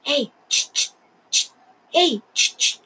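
put-on old man's hushed psst sounds; old man hisses to catch Love's ear; hissing psst calls. A narrator's reading. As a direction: hiss to get his attention. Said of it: "escuchó que alguien chistaba".

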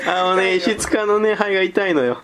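out of breath from laughing; slow, strained by laughter; after laughing hard. Real, not acted.

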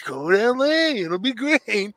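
high, goofy Muppet bear voice; Fozzie Bear. Retold or imitated. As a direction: play it as a hapless Muppet bear. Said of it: "I see Fozzie in the".

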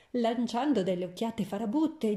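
usual speaking voice

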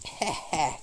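soft wicked heh heh; witch cackle. Acted. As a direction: chuckle like a witch. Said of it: "cackled the queen".

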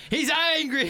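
high, loud, laughing mock alarm; Steve Irwin. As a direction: stretch it out as a hammed-up wildlife host. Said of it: "talking about Steve Irwin".

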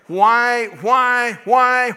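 high, loud, pleading cry of why; crying out to God. Retold or imitated. Preacher recalls begging God in prayer, loud anguished cry. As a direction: wail the why up at heaven.

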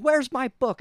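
high, loud mock stage yell; imitated yelling. Retelling the actor running across stage, imitated yell. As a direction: yell it out, imitating an actor hunting his prop.

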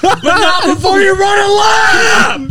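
high, slow, strained shout; mock coach yell. Coach sending Blake off to run, hammed-up yell. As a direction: draw out the order at the top of the voice. Acted coach ordering a punishment lap.

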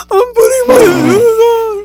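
high, loud, drawn-out fake wail; mock sobbing. Kid ordered down to the station, mock sobbing. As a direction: imitate a child bawling through the words. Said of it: "I literally start sobbing".

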